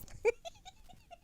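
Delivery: high breathy giggle up close; giggle into the mic. Real, not acted.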